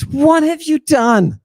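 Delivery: high, wailing rhetorical question; mock lament. Scolding the satanic congregation, high mock wail. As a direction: cry it out like a stage preacher.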